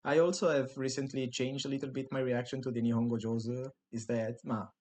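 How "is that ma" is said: ordinary delivery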